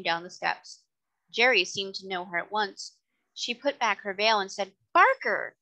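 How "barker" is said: high, loud cry of recognition; surprised hail. A narrator's reading. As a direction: call out to an old acquaintance. Lady hails driver she recognises.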